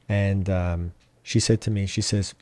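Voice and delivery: ordinary talk, nothing marked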